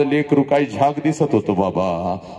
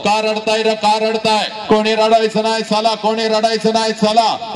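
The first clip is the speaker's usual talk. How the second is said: father shouting; 7 semitones higher than usual. Acted. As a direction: shout at the guests to stop weeping. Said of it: "सगळ्यांना ओरडतो".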